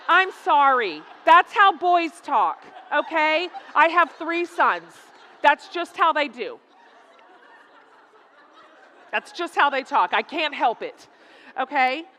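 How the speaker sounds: playful defensive protest. Real, not acted. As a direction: protest loudly over the laughter. Defending sons' talk to laughing audience.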